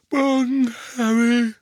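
high, sleepy yawned greeting; yawning. A narrator's reading. Tonks greets Harry after a sleepless night, yawning. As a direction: stretch it out through a yawn. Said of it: "gähnte Tonks".

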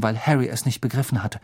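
usual speaking voice

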